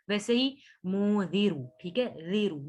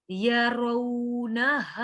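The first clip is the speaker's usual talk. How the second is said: slow, drawn-out tajweed recitation; Quran recitation; much slower than usual. Shown as a demonstration. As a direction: recite it with ghunna on the noon. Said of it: "नून को नहीं खींचेंगे बिल्कुल भी". (धीमी तजवीद वाली तिलावत)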